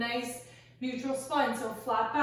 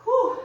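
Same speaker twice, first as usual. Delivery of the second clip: exertion exhale; 10 semitones higher, 11 dB louder than usual. Real, not acted. Exhale after a hard set.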